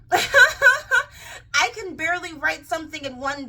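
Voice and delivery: high, slow incredulous laugh into words. Acted. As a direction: laugh in disbelief as you protest. Acting out writer's incredulous reply.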